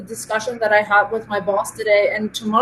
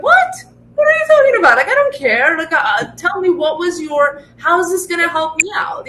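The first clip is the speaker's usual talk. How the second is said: imagined director reacting to jargon, high mock disbelief; incredulous boss; 7 semitones higher than usual. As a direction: play the baffled director snapping back. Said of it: "He's gonna, like".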